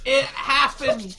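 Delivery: shouting with delight; excited shout. Real, not acted. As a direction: yell it out, thrilled. Rolled double sixes.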